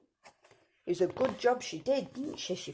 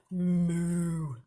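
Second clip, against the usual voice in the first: cow moo; 4 dB louder than usual. A narrator's reading. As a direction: imitate a cow with a drawn-out moo. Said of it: "And the cow said".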